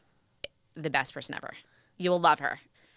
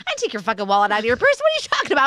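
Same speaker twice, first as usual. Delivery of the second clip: defensive outburst; 5 semitones higher, 5 dB louder, much faster than usual. Retold or imitated. Husband's defensive reply retold.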